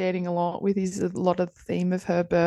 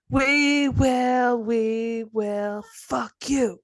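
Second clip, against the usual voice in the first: put-on kid's tough-guy threat; 4 semitones higher, much slower than usual. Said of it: "This is what I would say to the guy".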